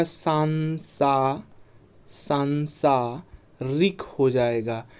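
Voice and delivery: slow, syllable by syllable स्ला-स्ला: सांसारिक stretched; drawn out word. Shown as a demonstration. Teacher spelling out सांसारिक slowly for students. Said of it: "इसमें दीर्घ हो जाएगा".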